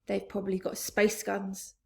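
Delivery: ordinary delivery, nothing marked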